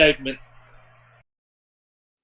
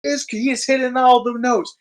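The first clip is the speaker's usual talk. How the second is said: excited; 8 semitones higher than usual. Retold or imitated. Narrating Rock promo excitedly.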